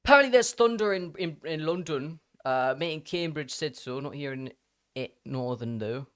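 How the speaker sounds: playful mock British accent; put-on British accent. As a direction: reread it in an exaggerated British accent. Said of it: "why I gave you that accent".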